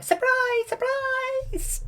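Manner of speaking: high-pitched cheerful surprise call; mock surprise shout. Fairies greeting Aurora, high-pitched mock surprise shout. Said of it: "and they're like".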